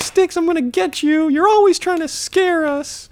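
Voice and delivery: high falsetto scolding; mock indignant girl. Girl threatening the boy who scared her, mock falsetto. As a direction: scold in a put-on girlish voice. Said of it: "she's like".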